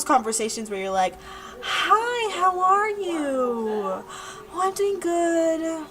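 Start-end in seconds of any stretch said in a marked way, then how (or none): 1.6-5.9 high, very drawn-out mock pleasantries; awkward small talk. Two people on the phone with nothing to say, awkward. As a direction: stretch out each polite phrase awkwardly in a put-on voice. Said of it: "one of those awkward conversations".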